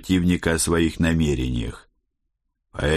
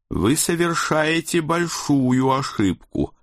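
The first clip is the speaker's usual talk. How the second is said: high, slow voice of a hissing official; hissed warning; 9 semitones higher, slower than usual. A narrator's reading. Committee member warns the defiant officer of his mistake, high, slow mild hiss. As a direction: lightly hiss it as the committee woman, pitched up. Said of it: "прошипела член комитета".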